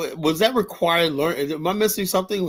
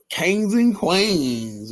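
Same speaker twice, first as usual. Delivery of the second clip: mock royal oration; 5 semitones higher, much slower than usual. Mock-grand speech about African royalty.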